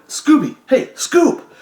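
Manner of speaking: high, loud call to wake him. Retold or imitated. Cellmate calling out to wake Scooby, loud. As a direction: shout him awake, half laughing.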